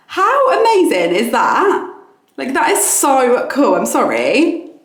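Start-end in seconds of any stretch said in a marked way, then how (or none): 0.1-3.7 much higher and louder, slow and gushing; thrilled. Real, not acted. Vlogger gushing to viewers over her new toothbrush, thrilled and loud. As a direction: let the delight burst out.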